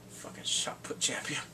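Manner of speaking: unvoiced muttered jab; whispered aside. Real, not acted. Fan whispering a jab to viewers under the breath.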